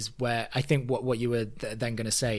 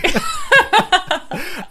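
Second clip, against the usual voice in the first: high burst of laughter; real laugh; 9 dB louder than usual. Real, not acted. Cracking up after imitating Ramona's outburst, a high laugh. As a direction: laugh out loud at your own impression.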